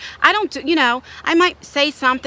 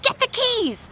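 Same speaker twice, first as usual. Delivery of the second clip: high-pitched angry command; urgent order; 12 semitones higher, slower than usual. Retold or imitated. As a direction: re-enact ordering the girls to the car. Angry mother orders girls to car.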